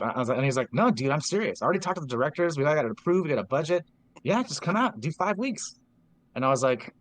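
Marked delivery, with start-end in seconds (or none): 0.7-5.8 higher, lively retold pitch; eager persuasion. Friend insists the summer teaching offer is real, eagerly persuading. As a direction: imitate a friend talking you into it.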